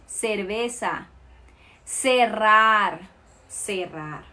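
cerveza and cerrar with an S sound, slowly; Latin American Spanish. Shown as a demonstration. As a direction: say cerrar with a clear S.

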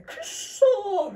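drawn-out laughing groan of defeat; mock frustration. Beaten by the young members, a slow laughing groan. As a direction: stretch the curse, laughing.

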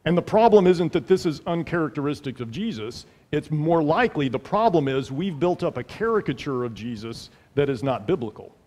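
ordinary delivery

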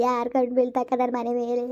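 mother crying to her sister. Acted. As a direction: sob out the question. Mother weeping over son.

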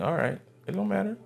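ordinary talk, nothing marked

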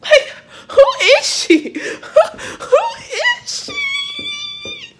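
high, loud laughing squeal; laughing shriek. Real, not acted. Overjoyed vlogger laughing at her own luck, high squeal.

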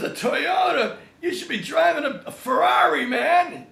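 high, loud disbelieving shout; incredulous mock. Stallone scoffing at Jim's car, loud disbelief. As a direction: scoff at a Toyota like it's a joke. Sylvester mocks his Toyota.

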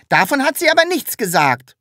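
high sulky protest; sulking. A narrator's reading. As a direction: grumble it like a pouting child. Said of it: "maulte das Sams".

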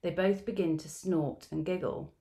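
ordinary talk, nothing marked

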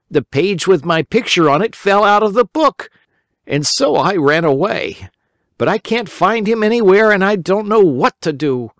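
put-on high, eager boy voice; a worried little boy pleading; Simple Simon. A narrator's reading. Simple Simon explaining to the rabbit, high boyish voice.

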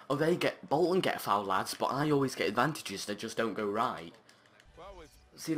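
usual speaking voice

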